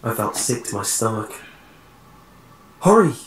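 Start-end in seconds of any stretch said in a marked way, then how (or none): 2.8-3.2 loud, high-pitched yell; urgent, raised cry; urgent shout. A narrator's reading. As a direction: shout it to hurry a friend along. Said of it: "shouted Jeremy".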